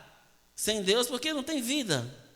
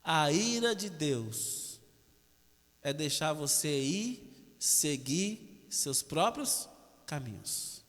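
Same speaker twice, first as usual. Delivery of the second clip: slow, much softer, lower, every word weighed; slow solemn emphasis; 6 semitones lower, 6 dB quieter, much slower than usual. Shown as a demonstration. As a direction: drop to a soft, slow "A ira de Deus" to make it stick. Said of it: "Grave isso aqui agora". (lento, baixo e solene)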